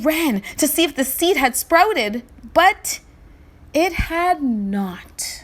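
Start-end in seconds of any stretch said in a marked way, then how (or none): 2.5-5.4 slow hushed whisper, dragging each word. A narrator's reading. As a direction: whisper the disappointment slowly. Story refrain, dramatic whisper.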